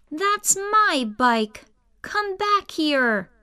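put-on high, angry kid calling after them; big kid shouting. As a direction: yell after the thieves like a cross big kid. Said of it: "he shouted".

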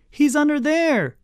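very high, eager cry; high-pitched, urgent giveaway; excited squawk. A narrator's reading. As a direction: squawk it out like a tattling child. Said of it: "squawked Reese".

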